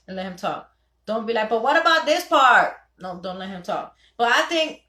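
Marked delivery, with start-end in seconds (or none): 1.5-2.8 loud, high cut-in; pushy wife jumping in; interrupting wife. Acted. Wife acting out interrupting her husband. 4.2-4.8 high, louder cut-in; eager interruption; interrupting wife. Acted. Wife acting out interrupting her husband.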